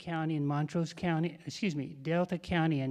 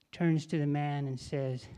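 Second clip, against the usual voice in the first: usual speaking voice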